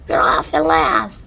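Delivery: loud joyful call; shouting. Acted. Creature shouting at departure, loud call. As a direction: yell it out to everyone. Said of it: "someone was shouting".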